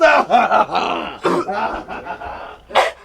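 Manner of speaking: triumphant shouted laugh. Acted. Debater cackling loudly over his win. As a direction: cackle in victory.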